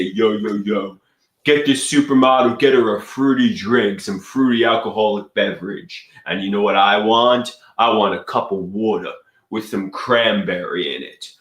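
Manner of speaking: quick put-on Brooklyn patter; fake Brooklyn accent. Ordering drinks from a bartender, quick fake Brooklyn accent. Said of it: "I'm putting on a fake Brooklyn accent".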